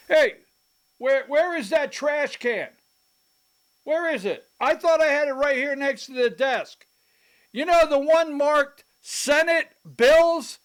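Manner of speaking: high-pitched, loud mocking politician voice; impression of John Burns. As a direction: ham up a fussy Speaker hunting for the trash can. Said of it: "my impression, John Burns".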